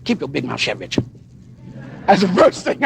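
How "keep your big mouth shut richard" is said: muttered to himself. Retold or imitated. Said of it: "I said to myself".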